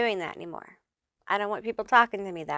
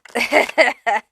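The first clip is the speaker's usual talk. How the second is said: short laugh; 4 semitones higher, 8 dB louder than usual. Real, not acted. Laughs at own reading.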